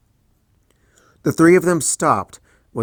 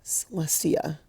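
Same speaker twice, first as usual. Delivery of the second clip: moan; 5 semitones higher, 8 dB quieter, slower than usual. A narrator's reading. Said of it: "Twilight moaned".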